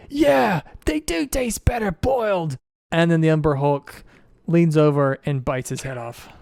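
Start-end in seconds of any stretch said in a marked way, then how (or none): 0.1-2.6 high-pitched voice of a gullible Duergar; Duergar's shouted reply. Acted. Game master's Duergar looks up and answers the taunt, high calling voice. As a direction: call back in agreement, pitched way up. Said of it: "he looks up and he says".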